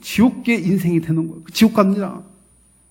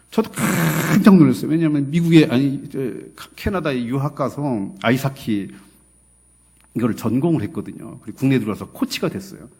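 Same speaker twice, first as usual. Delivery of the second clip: ordinary delivery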